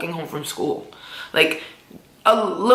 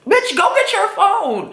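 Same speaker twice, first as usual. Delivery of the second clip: mock yell; 13 semitones higher than usual. First instinct, urging herself on.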